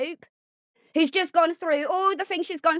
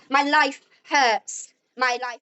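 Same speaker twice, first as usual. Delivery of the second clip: usual speaking voice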